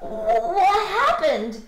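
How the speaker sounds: slow, high, loud surprise; surprised. A narrator's reading. Alexander finds Willie among old toys, surprised. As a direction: stretch it out in astonishment. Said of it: "said Alexander, surprised".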